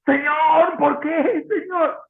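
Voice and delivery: high, loud anguished wail; crying out to God. Retold or imitated. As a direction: cry out Señor, por qué, in grief. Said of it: "Lloró así, con grito". (lamento agudo y fuerte, clamando a Dios)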